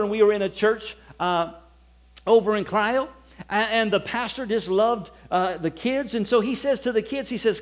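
ordinary talk

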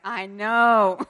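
loud, drawn-out mock exasperation; tired of reminders. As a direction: imitate a fed-up kid groaning back at a parent. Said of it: "he gets tired of us reminding him".

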